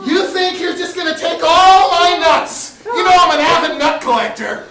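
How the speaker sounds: hysterical. Acted. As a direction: wail it at your partner.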